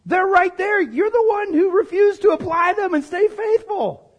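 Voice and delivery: high, forceful preaching retort; emphatic rebuke. Acted. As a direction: throw the blame straight back.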